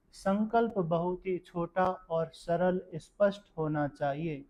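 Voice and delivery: ordinary talk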